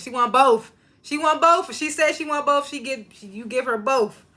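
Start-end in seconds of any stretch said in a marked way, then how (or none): 1.0-1.7 high, loud emphatic repeat. Real, not acted. Vlogger backs Brooklyn's wish for twins, high emphatic voice. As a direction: insist on both, higher.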